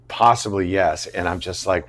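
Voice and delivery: normal talking voice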